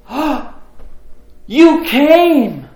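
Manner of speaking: loud high gasp, then an amazed cry; imitating an overwhelmed, high-pitched joyful outburst; tearful astonishment. Sister spotting her brother at the party, overwhelmed surprise. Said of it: "she starts weeping".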